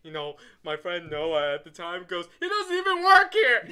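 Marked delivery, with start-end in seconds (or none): 2.4-3.7 higher, louder, laughed through; laughing outburst. Retold or imitated. Friend Noah laughing that the winner doesn't work there, laughing shout. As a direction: crack up while calling it out.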